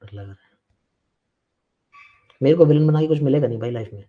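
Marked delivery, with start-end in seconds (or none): 0.0-0.5 soft whiny mock of a frightened pupil; a hushed, exaggerated scaredy-cat imitation; mocking scared student. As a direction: mimic the student's fake fear, quietly. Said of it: "नाटक मत किया कर इतना यार".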